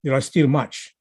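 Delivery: slow, deliberate newsreader delivery with long pauses; newscaster reading. Real, not acted. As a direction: read it out slowly like a newscaster. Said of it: "like a newscaster would, which is slowly and deliberately".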